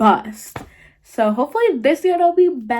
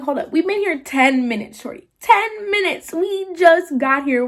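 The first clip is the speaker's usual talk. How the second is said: high-pitched acted objection; retold indignant comeback; indignant protest; 7 semitones higher than usual. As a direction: protest your innocence, pitch climbing. Acts out indignant protest to mom.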